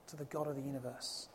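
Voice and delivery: usual speaking voice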